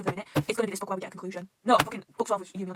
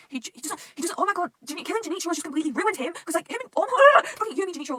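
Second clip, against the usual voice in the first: high, loud distressed gush; overwhelmed, voice shooting up; emotional outburst; 8 semitones higher, 8 dB louder than usual. Real, not acted. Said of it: "emotional outburst".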